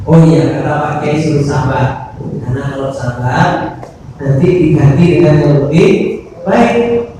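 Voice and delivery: ordinary talk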